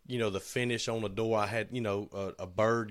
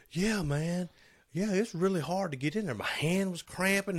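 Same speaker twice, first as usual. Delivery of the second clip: higher-pitched mimicking of a coworker; imitated coworker; 8 semitones higher than usual. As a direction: raise your voice to play the struggling coworker. Acting out coworker's reply.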